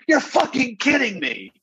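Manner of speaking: very high, slow incredulous cry; outraged disbelief. Retold or imitated. Contestant answering a silly judges' question, outraged disbelief. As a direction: draw it out in hammed-up disbelief.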